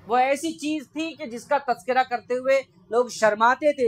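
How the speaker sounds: ordinary talk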